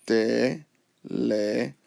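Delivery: "teléfono" broken into four slow, separate syllables. Shown as a demonstration. Teacher showing four syllables, "teléfono" slowly split with pauses. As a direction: say "teléfono" as te... le... fo... no, pausing between. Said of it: "If you count that, that's four syllables".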